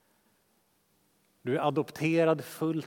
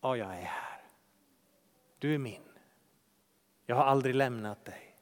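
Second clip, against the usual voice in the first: tender voice of God; 6 semitones lower, 5 dB quieter, faster than usual. Acted. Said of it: "Och så säger han".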